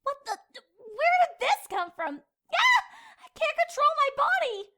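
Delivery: high, loud panicked cries. Acted. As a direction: shriek in helpless alarm. Panicking as body moves itself.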